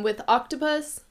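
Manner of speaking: ordinary talk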